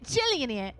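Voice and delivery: put-on Cockney accent. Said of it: "would hundred percent do the accent".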